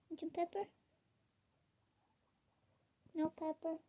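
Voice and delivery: high, gentle questions to a bunny; coaxing pet talk. Real, not acted. Offering food to pet rabbit.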